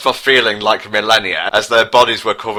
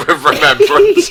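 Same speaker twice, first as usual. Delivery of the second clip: breaking into laughter mid-line; laughing; 7 semitones higher, 6 dB louder than usual. Real, not acted. Reader cracks up narrating Garfield's farewell, laughing. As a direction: giggle through the words.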